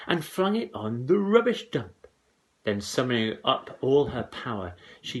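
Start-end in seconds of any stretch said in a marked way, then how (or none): 0.1-2.1 excited telling. A narrator's reading. Mother's outburst narrated.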